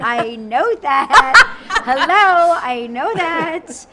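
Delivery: loud, long-drawn mock exasperation; exasperated comeback. Host retorting to her trainer's comparison, exasperated.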